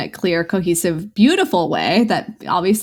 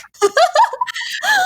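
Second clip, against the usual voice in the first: high-pitched laughing giggle. Real, not acted. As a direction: burst into a squeaky giggle. Laughing at own slowness.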